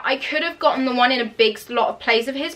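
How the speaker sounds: ordinary talk, nothing marked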